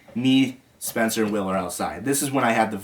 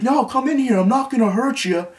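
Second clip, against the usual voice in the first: high-pitched mock coaxing; high, insistent put-on voice; imitated coaxing; 11 semitones higher than usual. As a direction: mimic someone urging him in, voice pitched way up. Imitating Dan coaxing him inside.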